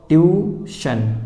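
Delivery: ट्यूशन drawn out slowly, ending in shun; slow demo pronunciation. Shown as a demonstration. Teacher to students, slowly modelling ट्यूशन. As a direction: spell it out as ट्यूशन, slow and clear. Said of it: "कैसे पढ़ोगे आप इसको".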